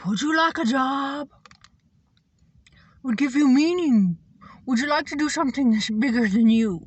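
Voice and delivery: a hint of a sing-song sales offer; mock job pitch. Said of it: "She like this".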